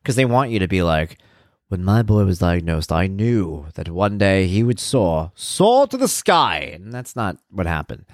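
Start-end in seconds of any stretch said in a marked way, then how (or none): 1.7-6.7 slow, grand, soaring declaration; mock inspirational. Mock inspirational parent speech.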